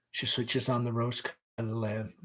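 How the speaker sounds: usual speaking voice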